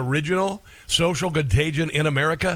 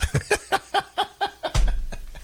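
high, airy burst of laughter; real laugh. Real, not acted. Host laughs at own joke.

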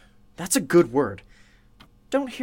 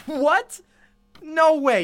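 high, loud burst of disbelief; stunned disbelief; 10 semitones higher, 5 dB louder, slower than usual. Real, not acted. Host reacting in shock to her first day, loud disbelief. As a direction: blurt it out in shock.

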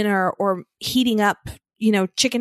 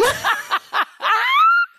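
squealing laugh, much higher; high laughter; 3 dB louder than usual. Real, not acted. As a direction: burst into high helpless laughter. Mom cracking up.

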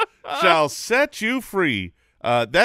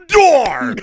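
door slam sound effect; 7 semitones higher, 7 dB louder than usual. Pretend. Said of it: "Did you just make the sound effect for a closing door".

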